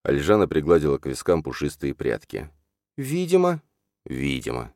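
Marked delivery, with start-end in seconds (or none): 2.9-3.6 high, lively heroine's ВИДИМО; high-pitched retort. A narrator's reading. Alzhana answering Lali's doubts, high-pitched retort. Heroine snaps back in argument. 4.1-4.7 low, soft, sour Видимо; sour echo. A narrator's reading. Lali repeating Alzhana's word, sour. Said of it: "скривился Лали".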